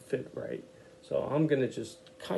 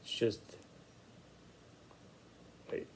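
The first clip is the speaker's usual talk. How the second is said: ordinary delivery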